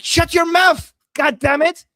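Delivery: high, loud furious yell; angry shout. Retold or imitated. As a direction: yell it at the annoying commenter. Translating the shout in character.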